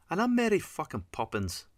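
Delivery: high, loud sarcastic outburst; angry rasp. A narrator's reading. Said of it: "Fiona rasped".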